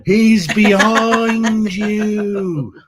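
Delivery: high, stretched-out panto yell; panto shout. Pretend. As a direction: bellow it like a pantomime audience. Panto call-and-response shout.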